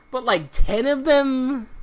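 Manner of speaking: high, loud and slow, disbelieving; incredulous scoff. Real, not acted. Gamer scoffing at ten collectibles, incredulous high and loud. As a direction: scoff at how many there are.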